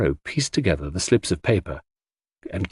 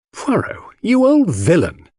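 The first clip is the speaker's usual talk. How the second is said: mock indignation; 11 semitones higher, 3 dB louder than usual. Narrator mock-scolds his friend.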